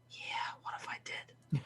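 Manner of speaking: breathy whispered realisation. Retold or imitated. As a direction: whisper it as if it just dawned on you. Client quietly realising.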